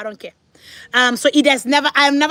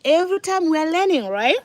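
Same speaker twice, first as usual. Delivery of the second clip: put-on higher-pitched child's reminder; lightly voiced child, pitched up; imitating own child; 6 semitones higher than usual. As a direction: mimic a little kid reminding mom. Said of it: "he said".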